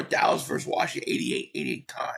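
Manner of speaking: ordinary talk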